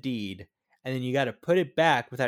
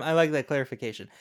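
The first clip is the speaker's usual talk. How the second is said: ordinary talk, nothing marked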